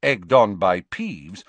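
ordinary delivery, nothing marked

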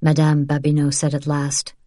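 ordinary delivery

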